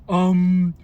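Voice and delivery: loud, drawn-out mocking um. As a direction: sneer a slow um at them. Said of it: "as if implying that I was stupid".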